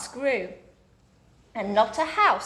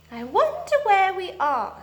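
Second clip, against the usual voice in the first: Silky; 9 semitones higher, 3 dB louder than usual. A narrator's reading. Silky wondering in story.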